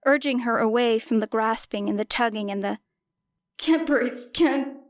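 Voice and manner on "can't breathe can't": panicking. A narrator's reading. Panic attack as she is led away.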